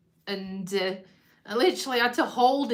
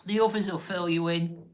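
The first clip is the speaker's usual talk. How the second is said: ordinary talk, nothing marked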